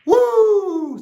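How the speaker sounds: high, loud wrestler's woo; a wrestler's shrill, booming whoop; Ric Flair woo. Shown as a demonstration. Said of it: "you're gonna say".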